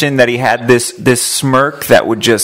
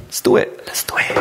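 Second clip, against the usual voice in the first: whispering; 6 dB quieter than usual. Real, not acted. Excited aside while connecting caller.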